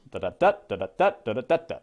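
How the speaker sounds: chanted anapests, every third "da" punched; beating out meter. Shown as a demonstration. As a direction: tap out the rhythm with the voice, da da DA. Demonstrates anapestic meter in syllables.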